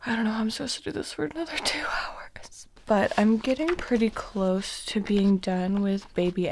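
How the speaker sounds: hushed late-night whisper. Real, not acted. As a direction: whisper so nobody wakes. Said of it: "we have been whispering because Matt is asleep".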